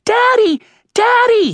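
put-on very high, loud child's squeal; small girl calling for her father; little girl squealing. A narrator's reading. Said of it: "he heard Megan squeal".

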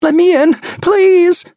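high loud frantic begging; desperate plea. Acted. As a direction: shriek to be let in. Woman pounding on car glass.

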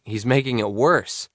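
ordinary delivery, nothing marked

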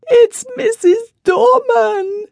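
high, slow tearful wife's voice; sobbing. A narrator's reading. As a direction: choke it out through sobs. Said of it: "she sobbed".

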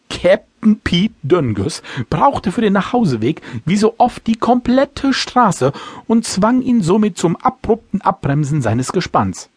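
usual speaking voice

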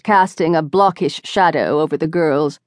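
ordinary delivery

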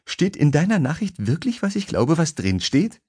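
a lightly voiced woman who can't believe what she read; woman on the phone reacting to a cheeky email; incredulous young woman. A narrator's reading. Alyssa voiced higher.

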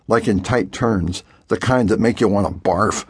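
usual speaking voice

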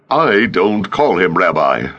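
ordinary delivery

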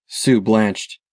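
ordinary delivery

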